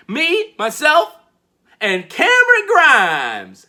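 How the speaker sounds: high, slow, loud self-important boast; drawn-out showman's boast; smug punchline. Retold or imitated. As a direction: land the joke with swagger. Grimes punchline.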